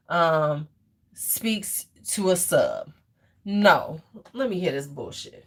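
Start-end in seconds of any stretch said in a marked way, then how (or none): 4.3-5.5 scornful whisper under breath; whispered aside. Real, not acted. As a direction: mutter it in a whisper. Muttering before playing clip.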